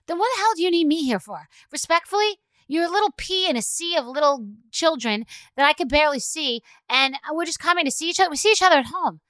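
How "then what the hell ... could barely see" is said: ordinary talk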